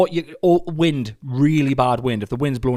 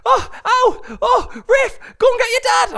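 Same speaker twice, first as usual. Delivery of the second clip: very high, panicked calling from another room; Rosie impression; 6 dB louder than usual. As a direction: wail for help in a high, breaking voice. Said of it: "this is a perfect impression".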